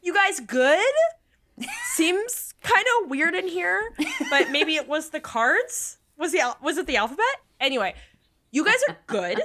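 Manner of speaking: teacher impression. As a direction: play the confused teacher checking in. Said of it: "Miss Tringle's like".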